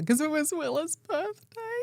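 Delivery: high-pitched tearful wail; mock sobbing, voice way up high; imitated child's crying. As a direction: wail it like an upset kid, exaggerated. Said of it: "a good cry in the back of the car".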